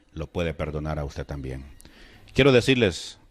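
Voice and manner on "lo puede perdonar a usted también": low, very soft quoted words; retold softly, as words barely let out; hushed forgiveness. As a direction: drop right down to a subdued, low voice. Retelling his words forgiving mother's killer.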